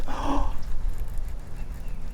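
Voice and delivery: sharp, breathy intake of surprise; gasp, cut off mid-thought; startled gasp. Real, not acted. Angler seeing a fish strike, startled gasp.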